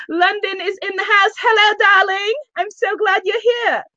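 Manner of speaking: high-pitched mock Guyanese-Londoner accent. Host reads out a London viewer's greeting, playful Guyanese-London accent. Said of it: "more of a Guyanese, Londonese accent".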